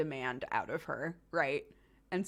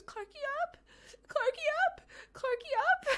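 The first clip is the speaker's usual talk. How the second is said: put-on very high squeaky toddler asking; piping little-kid plea for up; a tiny child's squeaky begging.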